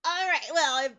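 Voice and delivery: high, loud, hurried-out fan thanks; retold flustered gushing. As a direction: re-enact gushing, then backing off apologetically. Retold flustered thanks before scooting off.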